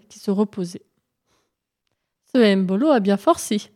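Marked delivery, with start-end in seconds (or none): none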